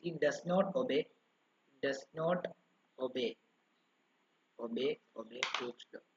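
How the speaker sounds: slow, word by word, so students can write it down; slow dictation. Shown as a demonstration. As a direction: dictate it piece by piece, repeating words. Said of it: "dictating a note to students". (വിദ്യാർത്ഥികൾക്ക് എഴുതാൻ പതുക്കെ പറഞ്ഞുകൊടുക്കുന്നു)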